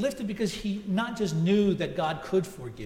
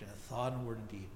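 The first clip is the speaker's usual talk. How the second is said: softer, hushed echo of the prayer line; penitent's quiet repeat; 6 semitones lower, 11 dB quieter than usual. Retold or imitated. Penitent man repeating the minister's prayer on the phone, hushed. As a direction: repeat it back low, like a man weighed down by guilt. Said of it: "And he repeated".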